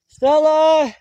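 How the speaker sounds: loud, high call for her. Real, not acted. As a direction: yell her name into the trees. Calling lost fox again.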